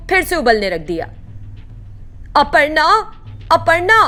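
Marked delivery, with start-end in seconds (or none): 2.4-2.8 first call. Acted. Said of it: "Shakuntala चिल्लाती रहती है". 3.5-4.0 louder call. Acted. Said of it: "Shakuntala चिल्लाती रहती है".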